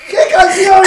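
high incredulous retold question; baffled. Friend answering a crying drunk about a song, baffled. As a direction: blurt it out, mock bewildered. Acts out own baffled reply.

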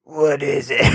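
groggy hoarse morning voice; smoker's rasp. Retold or imitated. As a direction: croak it like you just woke up. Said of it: "she's like".

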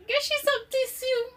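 high, loud, fussing concerned pastor. Retold or imitated. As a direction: play the alarmed pastor mothering a stranger. Worried pastor voiced in story.

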